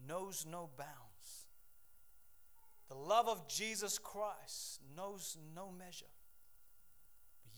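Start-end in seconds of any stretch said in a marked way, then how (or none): 0.1-6.1 slow emphatic. Acted. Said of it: "slow emphatic preaching".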